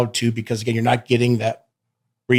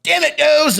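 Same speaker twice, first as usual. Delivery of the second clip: pained mock whine, much higher; Kyle griping; slower than usual. Opponent groans after each hit, pained mock whine. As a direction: whine it like you just got hit. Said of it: "Kyle's always just like".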